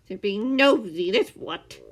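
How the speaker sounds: loud sharp retort; snapping. A narrator's reading. The man snapping at Scar, loud and sharp. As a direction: snap it back hard. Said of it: "the man snapped".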